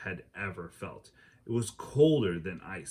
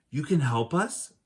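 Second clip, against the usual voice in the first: put-on high, louder hopeful girl asking; hopeful girl's question; 7 semitones higher, 5 dB louder than usual. Annie asking the ninjas, high hopeful girl voice. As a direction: ask it like a hopeful kid.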